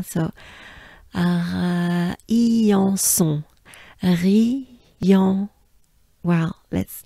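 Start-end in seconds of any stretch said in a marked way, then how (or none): 1.1-5.5 "Rion" with a breathy French uvular R; French R syllables. Shown as a demonstration. Teacher building the town name step by step. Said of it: "we pronounce the R".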